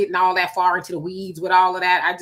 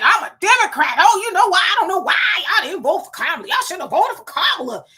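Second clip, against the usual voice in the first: mock [name]; 8 semitones higher, 5 dB louder than usual. Mock hypothetical [name] statement.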